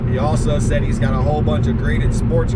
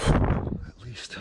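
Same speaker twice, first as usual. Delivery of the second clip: laughing; 2 semitones lower, 11 dB quieter than usual. Real, not acted.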